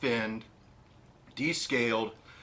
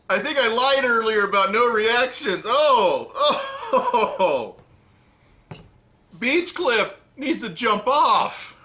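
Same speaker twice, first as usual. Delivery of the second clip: laughing in disgust, high and loud; 9 semitones higher, 8 dB louder, slower than usual. Real, not acted. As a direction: groan and laugh at the awful taste. Grossed out while tasting sardines.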